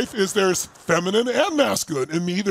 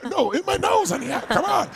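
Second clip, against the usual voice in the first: much higher, whiny comic protest; mock exasperated protest; 9 semitones higher than usual. Husband retelling being sprayed in the ear, high whiny protest. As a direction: whine it like a flustered victim.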